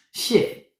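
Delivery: ordinary talk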